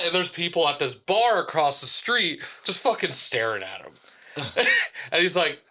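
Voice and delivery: usual speaking voice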